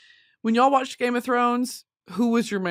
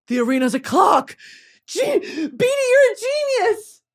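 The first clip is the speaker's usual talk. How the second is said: loud, high, excited squeal; Hunger Games impression; 12 semitones higher, 6 dB louder than usual. Streamer acting out a Hunger Games revelation, high excited impression. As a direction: shriek the discovery, voice shooting up. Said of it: "Both of those were Hunger Games impressions".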